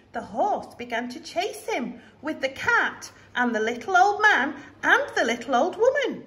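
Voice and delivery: ordinary delivery, nothing marked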